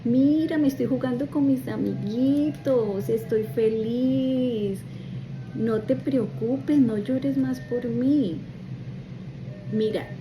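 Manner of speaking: put-on a child comforting his crying mother; her young son's voice. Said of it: "Y me decía". (voz de niño pequeño, más aguda y fuerte)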